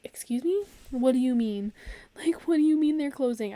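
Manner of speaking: high, loud outraged "excuse me"; incredulous disbelief. Retold or imitated. Learning the shop is closing, loud high indignant disbelief. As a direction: demand answers in shocked disbelief. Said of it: "and I was like".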